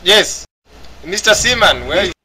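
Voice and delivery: normal talking voice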